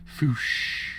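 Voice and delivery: a whispered whoosh; voiceless rushing whoosh; whoosh effect. Acted. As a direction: push out the air like a gust. Sound of stand power activating.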